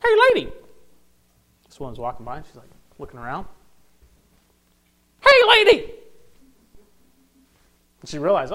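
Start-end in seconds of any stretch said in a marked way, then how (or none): 0.0-0.5 very high, loud parrot squawk; piercingly high talking-parrot call. Acted. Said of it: "and the parrot". 5.2-5.9 very high, loud parrot squawk; piercingly high talking-parrot call. Acted. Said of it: "and the parrot".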